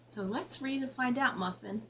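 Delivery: plain reading voice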